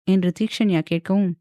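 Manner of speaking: plain reading voice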